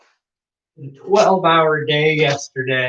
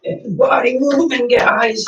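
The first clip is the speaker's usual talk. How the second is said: energetic coach call; 8 semitones higher, slower than usual. Real, not acted. Coach calling class to warm up.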